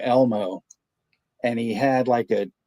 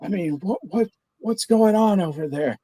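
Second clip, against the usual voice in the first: higher, pleading husband voice; prank caller; 8 semitones higher than usual. Retold or imitated. Prank caller asking the pizza clerk for his wife, pitched-up worried husband. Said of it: "he goes".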